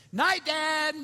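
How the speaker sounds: high, loud imitation of a kid calling out; perky child call. Daughter popping up in bed to dad, high loud mock child call. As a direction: pop up with a loud, high kid's shout. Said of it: "she pops up in bed and goes".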